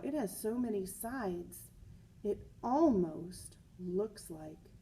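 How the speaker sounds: put-on lively read-aloud for children; story reading. A narrator's reading. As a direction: read the picture book to the class with energy. Reads picture book aloud.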